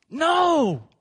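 high, loud fiery sermon call, each item flung out; preaching at full force. Acted. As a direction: drive the list home and rally the room to shout back. Preacher's shouted answer.

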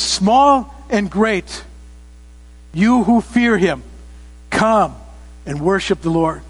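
slow, stretched-out summons, easing at the end; invitation to worship. Acted. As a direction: invite everyone in, phrase by measured phrase. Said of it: "It's an invitation".

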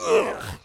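throaty burst of disgust; exasperated ugh. Acted. Preacher showing anger spilling out, exasperated ugh. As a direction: let pent-up anger burst out.

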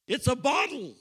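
high, loud crowd answer, called out together; crowd shouting back. Retold or imitated. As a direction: shout it back like five hundred people. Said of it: "they all shouted back".

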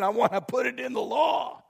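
high, mock-grand proclamation; hammed-up divine decree. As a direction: proclaim it as a playful God. Voices God decreeing the law.

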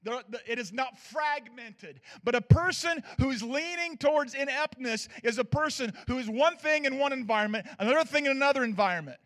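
normal talking voice

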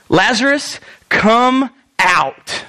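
firm order, each word set apart; authoritative command. Acted. Jesus calling Lazarus from the grave, authoritative command. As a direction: command it with pauses between the words. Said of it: "It's a command".

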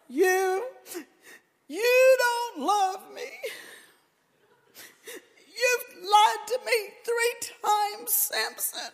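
hammed-up imitation of a whimpering woman; whimpering Delilah. Preacher mocking Delilah's tearful complaint to Samson, in a high, hammed-up whine. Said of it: "All that whimpering and crying".

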